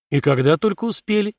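lightly sarcastic wonder; mocking sigh. A narrator's reading. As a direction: marvel at it with a touch of mockery. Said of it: "ёрнически вздохнул Дока".

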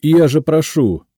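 loud, high plaintive appeal; tearful pleading. A narrator's reading. Cashier elbowing off the crowd, tearful pleading. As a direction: plead as if close to tears. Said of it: "плачущим голосом запел кассир".

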